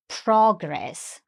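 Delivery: progress stressed on PRO; stress on first syllable. Shown as a demonstration. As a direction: lean on the start of progress, PRO-gress. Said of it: "with the stress on the first syllable".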